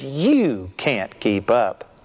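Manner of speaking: slow taunt with a long, punched YOU; imitated insult. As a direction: rub it in, stretching each word. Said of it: "when he insulted me".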